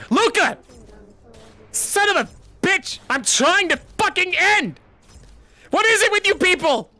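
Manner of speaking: high, loud exasperated yelling; furious shout. Real, not acted. Streamer yelling at friends who keep interrupting, furious shout. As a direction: scream it like they won't let you finish.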